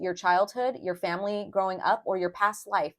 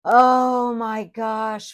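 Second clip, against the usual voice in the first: a loud, slow, stunned oh my gosh; overwhelmed gasp; 3 semitones higher, 7 dB louder, much slower than usual. Real, not acted. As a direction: let it spill out slowly, struck by the meaning. Overwhelmed by the card text.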